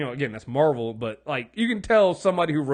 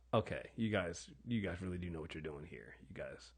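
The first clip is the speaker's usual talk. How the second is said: near-whispered, much softer, lower, trailing into nothing; hushed awe; 10 semitones lower, 17 dB quieter than usual. Retold or imitated. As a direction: say it under your breath, stunned by how good it is. Awed reaction to Hall of Doom.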